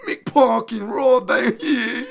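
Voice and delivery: high, loud silly English voice; mock English accent. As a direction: squeal the name like a dumb Englishman. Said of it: "that is essentially what I hear when I do".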